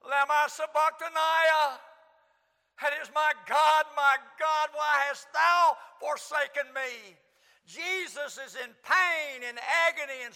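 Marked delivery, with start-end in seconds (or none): 0.1-1.8 loud, chanted cry of anguish. Acted. Preacher voicing Jesus crying out on the cross, anguished chant. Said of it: "He cries out". 3.1-7.1 loud, slow cry of abandonment; anguished cry. Acted. Preacher voicing Jesus on the cross, loud anguished cry.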